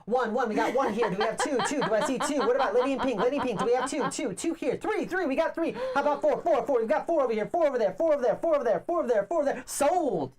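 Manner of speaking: high-pitched auction call with laughter; auctioneer voice. Pretend. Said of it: "Sometimes I even do my auctioneer voice".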